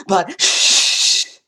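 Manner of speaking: unvoiced mock hush; conspiratorial shh; shushing. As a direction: whisper it like a secret. Hushing a secret aside.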